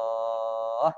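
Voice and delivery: "Indallah" with the last "aah" held long; long stopped ending. Shown as a demonstration. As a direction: chant "Indallah", thick l and long final vowel. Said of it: "Ini termasuk mat arid li sukun".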